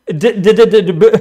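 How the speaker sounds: loud string of stuttered consonants; mock stammering. Streamer mocking their failed profound point, exaggerated stammer. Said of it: "stuttering, stammering bastard".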